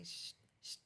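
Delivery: whispered shushing, very soft; hushing whisper. Retold or imitated. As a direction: hush the kids in a whisper. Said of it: "and my father used to say".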